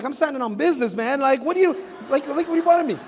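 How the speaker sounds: high, loud, lightly voiced modern swagger; young Jesus answering his parents, high loud swagger; self-assured Jesus. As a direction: tell them you've got work to do. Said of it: "Jesus was like".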